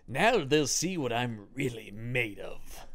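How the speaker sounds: slow, grand mock declamation; old star acting his heart out; Heston impression. Host imagining Heston's late-career drama, slow and overacted. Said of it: "Heston giving the acting his heart out".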